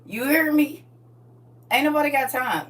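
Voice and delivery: put-on loud, high scolding of nosy kids; a mother yelling at nosy kids; mock scolding. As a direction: snap at the kids to go away.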